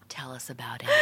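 breathy hushed prompt; mock whisper. Teasing a friend, urging her story in a whisper. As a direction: whisper it like a conspirator.

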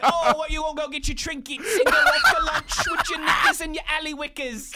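high, loud British caricature; loud, laughing British impression; mock British accent. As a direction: ham up a silly Brit. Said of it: "people over across the pond like".

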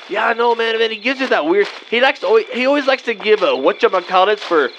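ordinary talk, nothing marked

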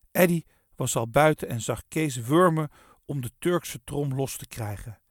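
ordinary delivery

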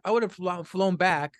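ordinary talk, nothing marked

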